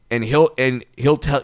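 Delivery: normal talking voice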